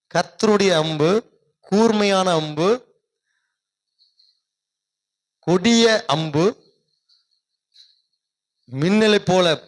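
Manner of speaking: slow, loud declaration of each line; loud, slow pulpit proclamation; preaching proclamation. Acted. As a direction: proclaim every phrase with weight, pausing between. Proclaims list of arrows.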